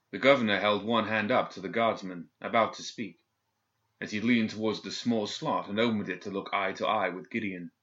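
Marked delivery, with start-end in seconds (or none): none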